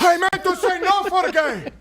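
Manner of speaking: put-on high, slow Cuban-accented correction; hammed-up Cuban accent, stretched out; Yoel Romero. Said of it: "in this heavy accent".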